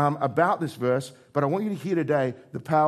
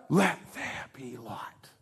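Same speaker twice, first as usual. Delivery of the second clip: slow, unvoiced whisper, much softer; whispered divine command; 2 semitones lower, 6 dB quieter, much slower than usual. Shown as a demonstration. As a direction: hush it out slowly like God speaking. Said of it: "he can even whisper it".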